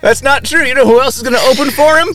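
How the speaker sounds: high-pitched excited ramble; gushing excitement. Acted. Improvised gushing right after sex.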